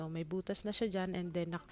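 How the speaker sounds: normal talking voice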